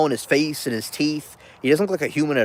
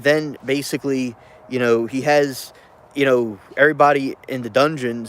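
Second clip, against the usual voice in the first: ordinary talk, nothing marked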